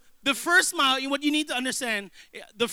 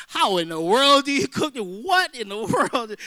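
baffled outburst; 2 semitones higher, 6 dB louder than usual. Retold or imitated. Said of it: "trying to do this with excellence".

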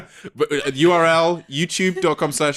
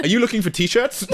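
chirpy assistant voice, higher; helper bot voice; 9 semitones higher than usual. Pretend. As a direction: sound like a cheerful helper pop-up. Voicing a Clippy-like helper.

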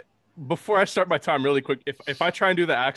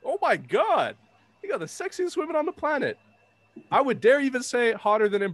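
excited gushing; 8 semitones higher than usual. Real, not acted. Gushing about Mexico's women.

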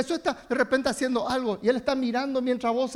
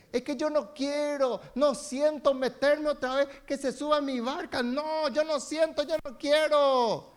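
whiny excuse; 6 semitones higher than usual. Retold or imitated. Mock excuse, drawn out.

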